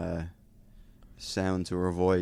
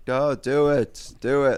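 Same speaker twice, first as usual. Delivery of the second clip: louder, higher, drawn-out urging; crowd egging on; 6 semitones higher, 5 dB louder, much slower than usual. Retold or imitated. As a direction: imitate a crowd goading someone.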